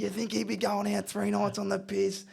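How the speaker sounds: high, mock-scolding coach voice; high-pitched comic telling-off; imitating angry coach. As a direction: berate the player as the coach. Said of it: "and he goes".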